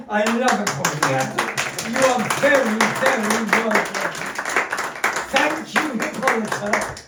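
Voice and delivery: high, loud, laughing gush of thanks; overjoyed gushing. Acted. Host thanking the spirit and medium, gushing and laughing. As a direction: gush over the spirit as if thrilled.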